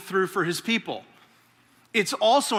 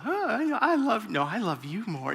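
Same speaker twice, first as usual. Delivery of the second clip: lightly imitating a lovestruck teen, high then fading; mushy boyfriend voice; 4 semitones higher, 4 dB quieter than usual. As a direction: coo it like a son sweet-talking his fiancée. He's doing that whole thing.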